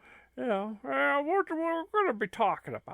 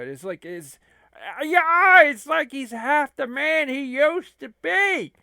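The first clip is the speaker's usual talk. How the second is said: a loud, worked-up lackey; Curly Joe voice; 3 semitones higher, 8 dB louder than usual. Acted.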